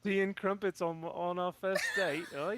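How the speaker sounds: high, loud Australian-Cockney put-on; jokey Aussie-Cockney chat-up; mock Aussie-Cockney accent. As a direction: ham up a Cockney-Aussie mix, half laughing. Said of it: "Australian and, like, Cockney".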